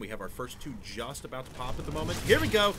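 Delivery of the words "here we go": high, loud hype call; excited. Real, not acted. Caster hyping as the first Hellions pop out. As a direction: lift the crowd as the action starts.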